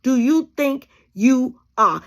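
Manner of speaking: loud, drawn-out challenge to a wrong person; defiant challenge. Pretend. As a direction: throw it back at them, slow and loud. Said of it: "okay, okay".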